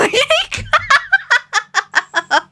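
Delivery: high-pitched burst of laughter. Real, not acted. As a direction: crack up. Laughs at the twist.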